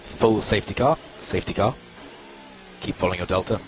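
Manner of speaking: ordinary talk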